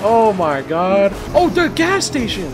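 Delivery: usual speaking voice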